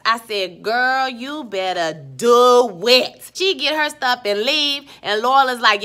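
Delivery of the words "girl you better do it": slow, loud, stretched-out cheer; hyped approval. Retold or imitated. Viewer eggs Annalise on after her threat, hyped. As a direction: draw out the key word in relish.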